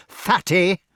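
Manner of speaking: fierce taunt. A narrator's reading. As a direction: jeer it fiercely. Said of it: "exclaimed the next cell fiercely".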